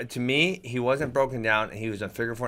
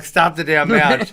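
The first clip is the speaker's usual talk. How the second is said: loud, high, drawn-out mock yell; imitating his own shout from the stands; retold yell; 6 semitones higher, 10 dB louder, slower than usual. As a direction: yell it out slowly to halt the bout. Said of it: "and I was yelling".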